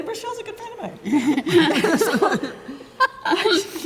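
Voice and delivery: high, loud laughter; giggling fondly over a friend; laughing. Real, not acted. Laughing about her friend.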